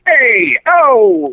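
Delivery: high, drawn-out goomba call; mock wannabe mobster. Imitating fake mob hangers-on, high goomba holler. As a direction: call it out like a fake Sopranos guy. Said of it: "those people were like".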